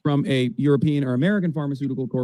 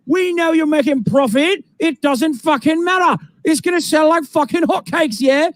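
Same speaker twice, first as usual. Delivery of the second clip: put-on shouting London accent; 10 semitones higher, slower than usual. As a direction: belt it out in a brash London accent. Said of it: "they're speaking in English in the U K".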